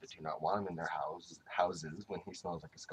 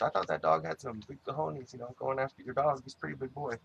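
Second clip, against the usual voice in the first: imitating the stranger; 6 semitones higher, 5 dB louder than usual. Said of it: "He goes".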